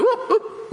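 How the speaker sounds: high mock dashboard alert; warning chime. God signals a new direction, mock warning beep. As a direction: chirp it like a car warning. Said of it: "and God goes".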